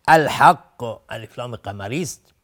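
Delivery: ordinary delivery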